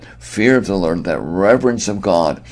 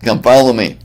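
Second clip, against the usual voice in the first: loud, raised-pitch military order; recruiting centurion; 6 semitones higher, 8 dB louder than usual. Acted. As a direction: order new conscripts to fall in behind you. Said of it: "if you're a centurion, your way of recruiting".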